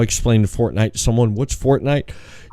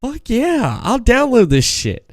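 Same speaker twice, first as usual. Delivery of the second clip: much higher, hammed-up eager yes; mock excited; 11 semitones higher than usual. Retelling agreeing to try the game, mock excited. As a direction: play up your own fake enthusiasm. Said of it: "I'm like".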